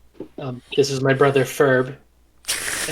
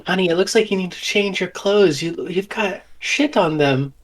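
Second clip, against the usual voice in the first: high-pitched put-on mom voice; high, coddling mother act; doting fussy mother; 5 semitones higher than usual. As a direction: fuss over your child in a high voice. Plays the mother.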